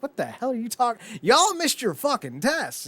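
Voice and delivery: high-pitched mock exasperation; imitated professor's outburst.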